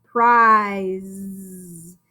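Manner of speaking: "Prize" drawn out with the long I; slow modelled word. Shown as a demonstration. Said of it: "I hear that long vowel".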